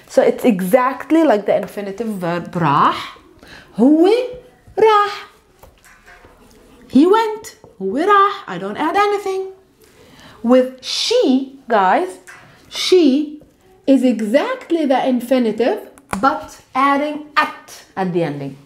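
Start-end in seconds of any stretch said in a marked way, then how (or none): none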